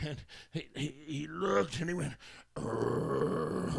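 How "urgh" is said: deep groggy growl from the drugged man; a low, drugged groan-growl. Retold or imitated. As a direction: growl low like a half-conscious beast. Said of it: "and growled".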